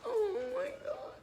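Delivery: high, loud pretend scared; hammed-up fear; mock fright. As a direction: play scared for the kids.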